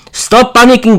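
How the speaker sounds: sharp high bark at the family; stern bark; barked order. Acted. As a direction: snap at them to stop. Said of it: "Zofia barked".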